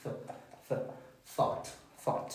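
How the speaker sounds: isolated soft th, then THOUGHT; English TH sound. Shown as a demonstration. Teacher demonstrates the TH in English, soft isolated sound then word. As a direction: build THOUGHT from its voiceless th. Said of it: "El sonido de la T H en inglés".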